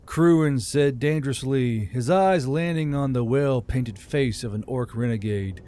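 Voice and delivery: ordinary delivery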